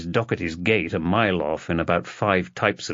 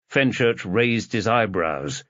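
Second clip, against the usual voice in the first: ordinary delivery, nothing marked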